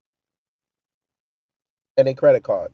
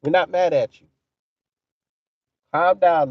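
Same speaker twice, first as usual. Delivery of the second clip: ordinary delivery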